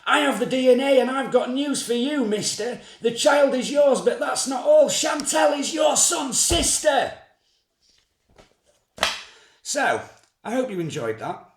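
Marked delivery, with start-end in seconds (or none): 0.1-7.1 Jeremy shouting. Acted. Host yelling the DNA result at the father. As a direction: bellow the shocking result at him. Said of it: "Jeremy shouts".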